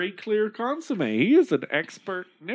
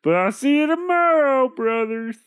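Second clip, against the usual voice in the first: chirpy simpleton; 6 semitones higher, 5 dB louder than usual. Acted. Simpleton says goodbye.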